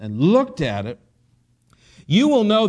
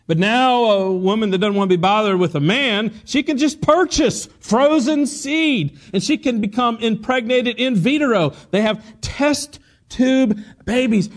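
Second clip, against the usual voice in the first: high, stretched-out pulpit emphasis; emphatic preaching; 6 semitones higher, 4 dB louder than usual. Real, not acted. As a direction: hammer out each marvel for the congregation. Preaches at full force.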